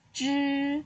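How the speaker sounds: Zhi with tongue curled up to the palate; retroflex zhi. Shown as a demonstration. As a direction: curl the tongue back for Zhi. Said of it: "lidah kamu ke atas".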